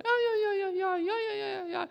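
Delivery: high wailing cries of pain; imitating grandma crying in pain. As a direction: wail it like a grandmother sobbing with a migraine. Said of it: "She was crying".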